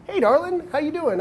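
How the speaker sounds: hamming up a cheesy opener; mock flirty line.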